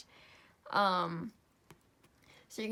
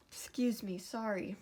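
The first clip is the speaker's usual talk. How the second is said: ordinary talk, nothing marked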